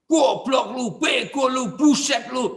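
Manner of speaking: mock furious yelling, high and loud; preacher acting out an angry man, high shouted insults; angry shouting. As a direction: yell the insults like a hothead. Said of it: "Orang marah itu kehilangan akal sehat".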